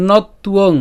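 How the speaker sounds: teacher's forceful model of ন তুয়ং; emphatic demonstration. Shown as a demonstration. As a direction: punch out ন তুয়ং as not-tuong. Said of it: "এখানে ন".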